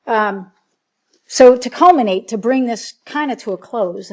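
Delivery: usual speaking voice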